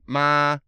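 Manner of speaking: ma held level and steady; flat first tone. Shown as a demonstration. Teaching the first tone, ma said flat and level. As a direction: say ma on one flat pitch, like a hand on a table. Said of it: "first tone is, uh, flat tone".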